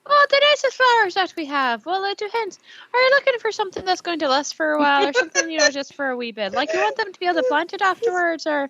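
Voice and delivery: put-on Irish-New Zealand accent. Orc flower seller answers the customer in an Irish-Kiwi accent. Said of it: "Accent changes".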